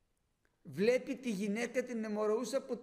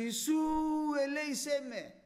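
calling out to Jesus; 6 semitones higher, 5 dB louder than usual. Shown as a demonstration. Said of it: "Και να φωνάξει".

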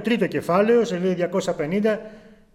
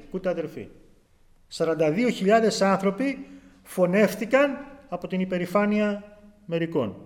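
ordinary talk

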